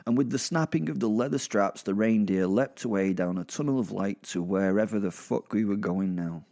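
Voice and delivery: plain reading voice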